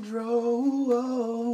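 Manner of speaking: high, drawn-out hype call; high echo. Acted. Echoing Hydro homie to the co-host, high drawn-out call. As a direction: sing it out high like a shoutout.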